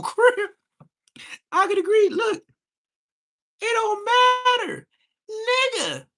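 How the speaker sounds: shrill, high, loud mock voice of a sniffer; high squeaky mocking brag; mocking impression. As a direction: play the smug sniffer in a squeaky shout. Mock voice resumes.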